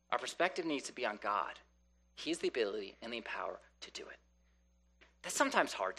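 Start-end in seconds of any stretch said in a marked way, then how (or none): 2.1-4.2 fast, hushed whisper to the church. Real, not acted. Preacher whispers to the congregation about God's power. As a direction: lean in and breathe the words.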